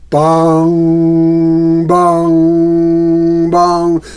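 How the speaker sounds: loud, ringing bong of a clock; mock clock chime. Storyteller booming the midnight chimes. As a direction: boom out the chimes like a big clock.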